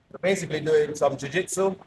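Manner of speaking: ordinary talk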